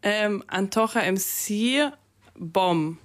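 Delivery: showing the host how to say "Antocha M C Bom"; careful pronunciation. Shown as a demonstration. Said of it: "du müsstest mir einmal helfen mit der Aussprache".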